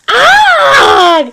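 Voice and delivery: high, loud scream of pain. Acted. Grell screams when the chainsaw lands on his foot. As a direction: shriek as if you stubbed your foot. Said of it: "His scream caused the cat to run".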